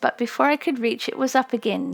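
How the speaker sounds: plain reading voice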